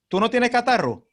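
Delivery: ordinary talk, nothing marked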